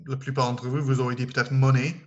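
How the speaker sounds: ordinary talk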